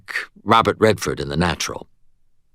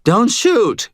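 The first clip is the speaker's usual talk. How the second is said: pleading; 13 semitones higher, 5 dB louder than usual. A narrator's reading. Terrified cashier pleads.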